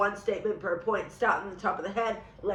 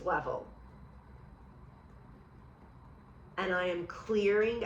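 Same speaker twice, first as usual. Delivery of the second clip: guided affirmations; 2 semitones lower, 5 dB quieter than usual. Acted. Healer guiding viewers through a tapping release, calm and soft. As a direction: talk the listener gently through letting go.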